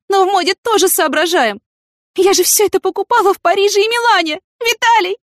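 very high, melodramatic lament; wailing heroine. A narrator's reading. Yana mourning her lost designer outfits, distraught.

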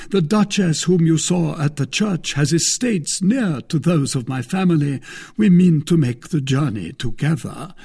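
a touch of pointed provocation; Porthos. Acted. Porthos needles the wife.

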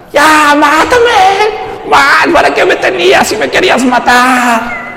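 high, slow defiant whine; cheeky kid. Retold or imitated. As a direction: play your younger defiant self. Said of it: "salió [name] el israelita". (niño descarado, agudo)

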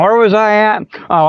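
ordinary talk

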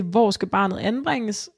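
ordinary talk, nothing marked

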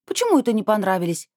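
indignant. Acted. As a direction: take offence at the remark, voice up. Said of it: "возмутилась Анжелика".

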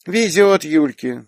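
put-on wistful little girl. A narrator's reading. Little girl envies Yulia her grandparents, wistful. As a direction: draw it out with childish envy. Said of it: "вздохнула Лея".

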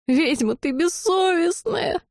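high, slow, tearful wail; sobbing friend scolding the girl who nearly died, wailing; wailing through tears. A narrator's reading. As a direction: howl it out in relieved sobs. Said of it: "Рыдая в голос ... провыла Лалина".

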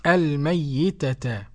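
الميتة very slowly as al-mayyitata; Abu Ja'far reading. Shown as a demonstration. Presenter echoing the sheikh, slow doubled ya. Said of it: "قرأ أبو جعفر فقط لوحده كده".

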